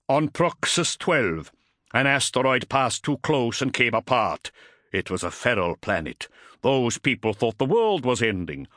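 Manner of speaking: grim veteran telling what he saw; gruff Space Wolf warrior. Acted. Voices Bringar recalling an asteroid disaster.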